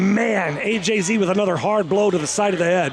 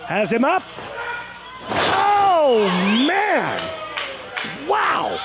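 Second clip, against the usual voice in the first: excited, higher play-by-play call; wrestling commentator; 4 semitones higher than usual. Acted. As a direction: call the big moves like ringside.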